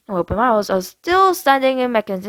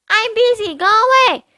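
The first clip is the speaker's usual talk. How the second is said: put-on much higher, childish little sister voice; little sister chattering from the bathroom; little girl voice; 14 semitones higher, 4 dB louder than usual. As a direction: play a carefree little girl. Brianna voiced.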